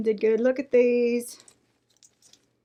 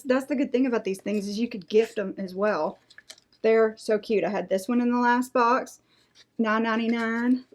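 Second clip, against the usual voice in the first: usual speaking voice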